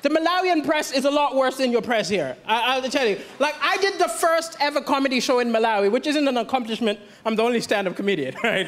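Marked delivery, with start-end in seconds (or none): none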